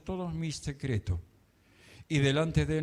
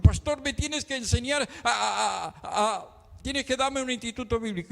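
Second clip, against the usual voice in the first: higher-pitched stammering imitation of a woman; imitated insistent churchgoer; 5 semitones higher than usual. Church sister to pastor asking for an institute, insistent. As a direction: mimic her demand in a raised, stumbling voice.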